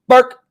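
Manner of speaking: high, loud imitated single bark; dog bark imitation. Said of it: "your sound effects are spot on".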